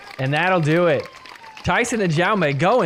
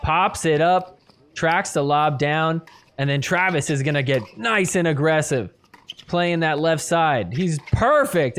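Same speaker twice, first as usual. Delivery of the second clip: ordinary talk, nothing marked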